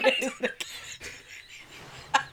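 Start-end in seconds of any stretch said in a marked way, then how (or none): none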